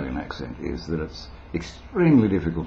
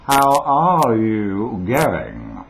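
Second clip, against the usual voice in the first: slow, loud radio-neutral Australian, every word separate; carefully enunciated, toned-down Australian accent; neutralised Australian accent; 3 semitones higher, 6 dB louder, much slower than usual. Shown as a demonstration. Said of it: "it was that neutralised thing".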